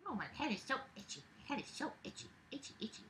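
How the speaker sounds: fast, soft, breathy whisper; hushed itchy mumble. Real, not acted. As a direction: fret softly while scratching.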